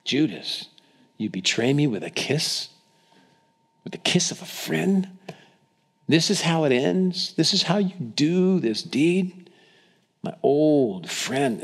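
understated pained reproach, pitch lifting on questions; hurt disbelief. Acted. Jesus wounded by Judas's betrayal.

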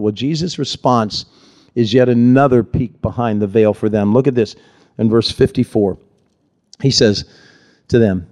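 ordinary talk

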